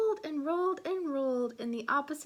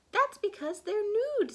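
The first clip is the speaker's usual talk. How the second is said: put-on high little girl voice; 9 semitones higher, 6 dB louder than usual. Sister's silly quip.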